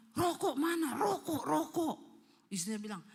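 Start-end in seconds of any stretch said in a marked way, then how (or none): 0.1-2.0 loud, raised yell demanding cigarettes; possessed man yelling. Retold or imitated. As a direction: imitate a possessed man's frantic yelling. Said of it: "teriak-teriak". (teriakan orang kerasukan menagih rokok)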